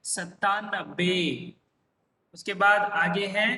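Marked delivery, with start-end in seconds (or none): 0.1-1.5 सत्तानबे said slowly, syllable by syllable; teacher modelling सत्तानबे slowly; slow pronunciation demo. Shown as a demonstration. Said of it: "क्या हो जाता है?".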